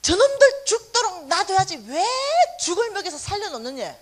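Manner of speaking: loud rough scolding shout, high and harsh; a grumpy vendor yelling at a passer-by; gruff shout. Retold or imitated. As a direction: bark at her like an angry street vendor. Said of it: "이렇게 말하는 거야".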